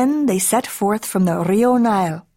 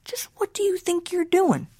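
high-pitched scolding mama cat; 11 semitones higher, 3 dB quieter than usual. Acted. Mama cat to her boy, scolding. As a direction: pitch it high, a mother demanding an answer.

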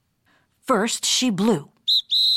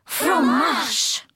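higher, chanted French answer; French poodle chorus; 4 semitones higher than usual. A narrator's reading. Poodles answer French teacher, chanting together. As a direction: chime the French word together, high.

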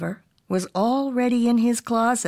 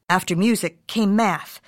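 plain reading voice

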